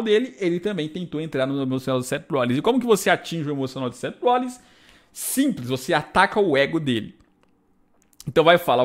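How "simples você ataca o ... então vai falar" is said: ordinary delivery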